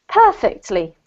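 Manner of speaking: perfectly as PER-fectly, falling; stress on first syllable. Shown as a demonstration. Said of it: "emphasis on the first syllable".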